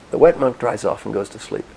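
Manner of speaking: normal talking voice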